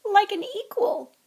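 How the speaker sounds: high, tearful elf voice; high, weepy elf voice; tearful house elf. A narrator's reading. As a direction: overcome elf, voice cracking high. Narrator voices Dobby.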